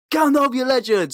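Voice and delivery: high, loud excited cheer; cheering shout. Retold or imitated. Crowd at the festival cheering Gandalf, loud excited shout. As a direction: yell it like a thrilled festival crowd.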